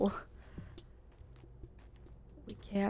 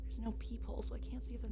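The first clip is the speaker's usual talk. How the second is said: hushed nervous whisper; 2 semitones higher, 10 dB quieter, much faster than usual. Real, not acted. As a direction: whisper so no one hears. Worried about knocking at door.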